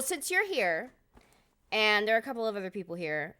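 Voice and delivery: ordinary talk, nothing marked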